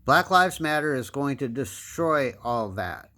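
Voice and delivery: normal talking voice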